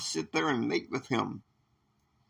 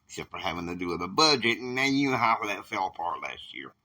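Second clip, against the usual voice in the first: ordinary delivery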